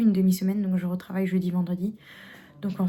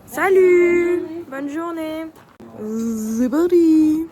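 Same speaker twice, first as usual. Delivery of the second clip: excited shout; 5 semitones higher, much slower than usual. Real, not acted. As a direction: shout it out with joy. Shouting happily on the slopes.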